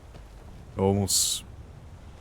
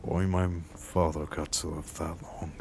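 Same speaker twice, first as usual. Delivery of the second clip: softer, heavy-hearted, with long pauses; somber remembrance; 2 semitones lower, 8 dB quieter than usual. Acted. Recalls father killed by bandits.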